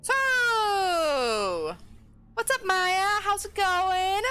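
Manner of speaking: overly bright sitter voice; cheery babysitter greeting. Acted. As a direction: win over a shy child with bright cheer.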